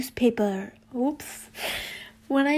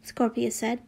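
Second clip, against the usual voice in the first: ordinary delivery, nothing marked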